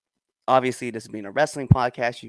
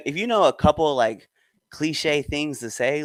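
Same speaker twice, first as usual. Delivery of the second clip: ordinary delivery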